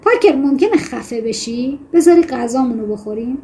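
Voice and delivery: ordinary talk, nothing marked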